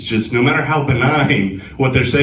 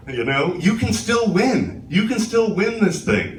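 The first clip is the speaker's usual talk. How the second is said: slow, higher, coaxing reassurance; police negotiator; 9 semitones higher, slower than usual. Pretend. Pleading with Trump like a police negotiator, slow and coaxing. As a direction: talk him down off the ledge gently. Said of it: "If I sound like a police negotiator".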